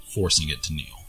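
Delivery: ordinary delivery, nothing marked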